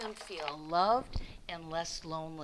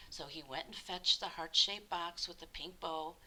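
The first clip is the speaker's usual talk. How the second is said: plain reading voice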